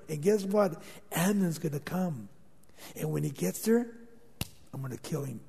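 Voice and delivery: dropped to a soft, menacing murmur; sinister plotting hush. Acted. Absalom secretly plotting his brother's death, soft and menacing. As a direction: lean in and quietly plot the killing.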